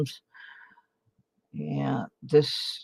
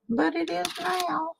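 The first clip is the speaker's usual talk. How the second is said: high playful voice; 3 dB quieter than usual. Pretend. Mock proud high voice.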